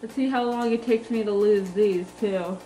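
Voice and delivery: usual speaking voice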